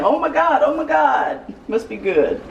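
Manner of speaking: imitating daughter. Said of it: "She kept saying".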